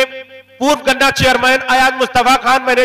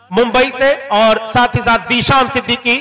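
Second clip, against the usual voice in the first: normal talking voice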